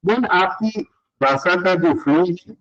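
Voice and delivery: ordinary talk, nothing marked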